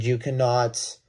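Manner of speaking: normal talking voice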